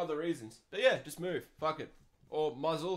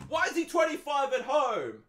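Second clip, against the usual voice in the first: high, loud, exasperated shout; exasperated outburst; 10 semitones higher, 8 dB louder than usual. Real, not acted. Advice host ranting about a brother still at home, exasperated shout. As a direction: burst out in disbelief.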